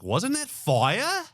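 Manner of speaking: high, slow mock Australian question; drawn-out Aussie twang; imitating Aussie interviewer. Interviewer mimicked asking [name], slow mock Australian accent. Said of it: "the interviewer was like".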